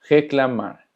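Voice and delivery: Reclamar said "heh-kla-MAR" with a throaty h start; Reclamar, its first R as a breathy h; Portuguese R. Shown as a demonstration. Teacher modelling initial R for Spanish speakers, throaty h. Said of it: "se pronuncia reclamar".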